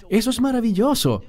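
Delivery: high, quick and delighted; a believer thrilled that a friend will read the Bible; enthusiastic believer. Retold or imitated. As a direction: cheer the friend on brightly. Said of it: "él te animará y te dirá".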